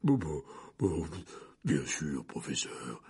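very low voice of a dazed big man; Hagrid. A narrator's reading. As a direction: agree in a deep, dazed rumble. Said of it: "répondit Hagrid qui semblait ne pas croire à son bonheur". (voix très grave, ébahie)

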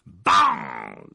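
a sudden loud bang sound; loud imitated bang. Narrator imitates the bus detonation, sudden loud bang. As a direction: burst out with the blast.

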